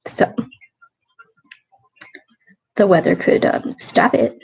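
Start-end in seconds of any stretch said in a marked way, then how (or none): none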